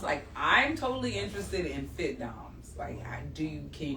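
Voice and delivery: whisper. Real, not acted. Asks shyly about fit doms.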